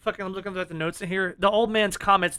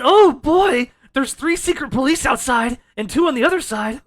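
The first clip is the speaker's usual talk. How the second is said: excited granny; 5 semitones higher, 9 dB louder than usual. Retold or imitated. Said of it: "She said".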